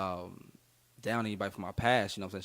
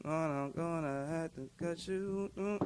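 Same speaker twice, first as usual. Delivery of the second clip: higher, slow, drawn-out put-on voice; imitating producer; 8 semitones higher, slower than usual. Producer teasing about the track, slow higher put-on voice. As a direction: stretch it out slowly like a sly producer. Producer naming the beat, retold.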